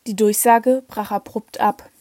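ordinary delivery

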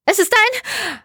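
very high-pitched cry; frightened cry. A narrator's reading. Jelena cries out over the radio, frightened. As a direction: cry out in alarm, voice leaping way up. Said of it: "schnarrend hallte ihr Schrei".

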